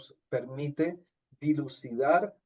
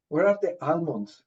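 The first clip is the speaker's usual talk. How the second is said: put-on Spanish-accented English. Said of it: "Y me dije".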